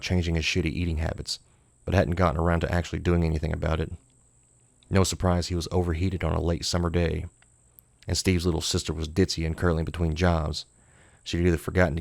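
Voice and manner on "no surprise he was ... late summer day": ordinary delivery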